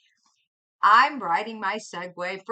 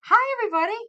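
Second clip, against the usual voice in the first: 1 excited greeting; 13 semitones higher, 7 dB louder, much faster than usual. Real, not acted. Greeting chat.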